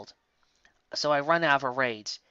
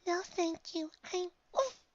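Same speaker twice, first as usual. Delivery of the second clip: high, soft refusal cut off by an oof; timid little pony; 7 dB quieter, slower than usual. A narrator's reading. Shy pony refusing snow and getting hit, timid voice. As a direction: decline sweetly, then get knocked mid-word.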